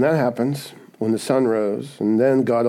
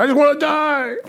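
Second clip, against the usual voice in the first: whiny Jonah; 4 dB louder than usual. Retold or imitated. Preacher voicing sulking Jonah, high whiny plea. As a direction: whine it like a sulking prophet. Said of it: "How do you feel, Jonah".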